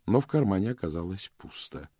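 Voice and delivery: ordinary delivery, nothing marked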